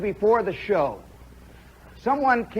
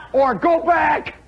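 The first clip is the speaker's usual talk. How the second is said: mock shout; 6 semitones higher, slower than usual. Suggesting a slogan for graduates, slow mock shout. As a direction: bellow it like a crowd chant. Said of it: "shouted suggestion".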